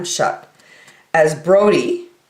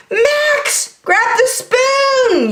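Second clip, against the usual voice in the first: yell; 3 dB louder, much slower than usual. Acted. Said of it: "yelled Dakota".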